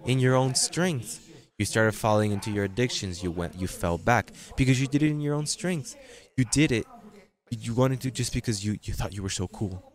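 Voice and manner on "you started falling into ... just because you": normal talking voice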